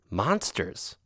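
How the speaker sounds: low, hushed single word; ominous hush. Acted. Friend cat answering with a hushed, ominous word. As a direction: drop it quietly like a warning.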